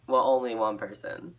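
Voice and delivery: ordinary delivery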